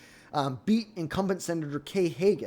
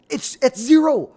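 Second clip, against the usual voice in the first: stunned, loud and slow; incredulous outburst; 9 semitones higher, 10 dB louder than usual. Real, not acted. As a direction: blurt it in disbelief. Outraged at zero tariff claim.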